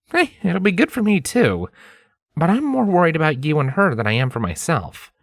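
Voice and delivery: reassure, then show real concern. A narrator's reading. Lens voiced in story.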